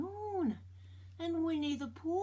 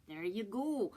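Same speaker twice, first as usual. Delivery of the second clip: ordinary delivery, nothing marked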